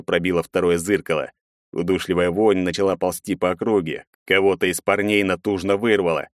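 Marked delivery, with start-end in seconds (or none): none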